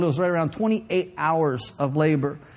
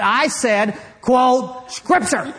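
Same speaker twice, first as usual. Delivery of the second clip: more forceful; 3 semitones higher, much slower than usual. Retold or imitated. Wife in labor pressing her husband, more forceful. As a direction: insist through clenched patience. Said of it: "she had to say it a little bit more forceful".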